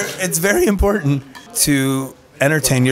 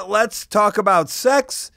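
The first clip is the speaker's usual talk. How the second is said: quoting Val Venis; 7 semitones higher, slower than usual. Retold or imitated. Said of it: "Like the great Val Venus once said".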